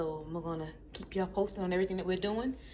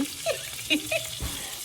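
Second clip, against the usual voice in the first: breathy laugh; 13 semitones higher than usual. Real, not acted. Laughs at own mix-up.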